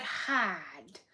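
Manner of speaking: imitated Boston "Hard", no R; a friend's Boston "hahd", drawn out; Boston accent. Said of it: "why do you sound like a Minecraft villager".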